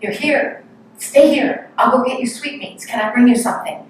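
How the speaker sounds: thrilled disciple rushing to offer sweets; excited welcome. Retold or imitated. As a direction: gush over the returning guru. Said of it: "agitated about this in a, in a good way".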